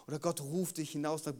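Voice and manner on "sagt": normal talking voice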